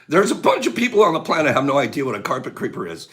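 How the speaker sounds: normal talking voice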